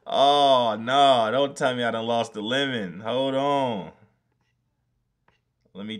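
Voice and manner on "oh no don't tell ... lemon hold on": loud anguished cry; loud dismay. Real, not acted. Streamer realising the lemon file is gone, loud anguished cry.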